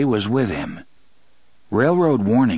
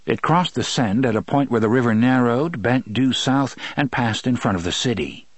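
plain reading voice

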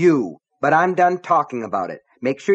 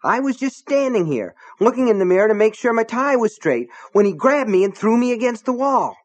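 higher, louder shaken protest; rattled but still indignant; higher, louder shaken, angry deputy; trembly, still angry; 10 semitones higher, 4 dB louder than usual. A narrator's reading. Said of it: "His voice was trembly".

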